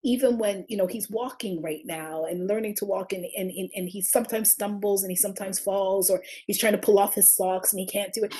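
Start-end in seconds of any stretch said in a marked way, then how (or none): none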